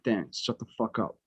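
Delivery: low, soft, annoyed aside; hushed telling-off. Retold or imitated. Student hushing his friend in study hall, quiet and low. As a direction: hush your buddy without the teacher hearing.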